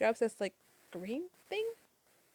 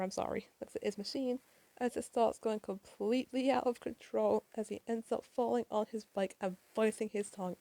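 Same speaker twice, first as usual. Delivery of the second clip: ordinary delivery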